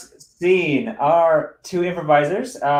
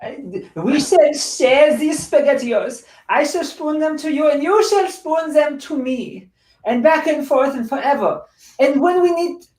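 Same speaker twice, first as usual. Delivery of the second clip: higher, grandly mock-tender lover; hammed-up romantic; 7 semitones higher than usual. Rat wife proposes sharing SpaghettiOs, hammed-up romantic. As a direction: woo him in a high, mock-noble voice.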